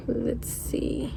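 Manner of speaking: soft whispered aside; whispering. Real, not acted. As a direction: whisper to yourself. Quiet aside while measuring.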